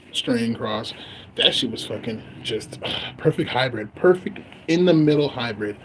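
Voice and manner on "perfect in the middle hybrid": ordinary talk, nothing marked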